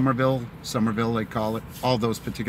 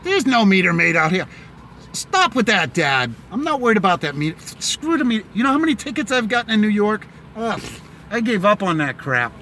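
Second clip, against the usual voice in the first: higher-pitched, fed-up complaining; exasperated rant; 7 semitones higher than usual. Real, not acted. Fed up with New York parking tickets, exasperated. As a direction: rail against it, pitch up.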